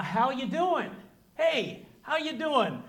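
casual cliche greeting. Retold or imitated. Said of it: "the cliche conversation is something like".

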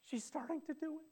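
a parent amazed at the first steps; excited parent. Retold or imitated. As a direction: marvel as the baby starts to walk. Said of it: "You're like".

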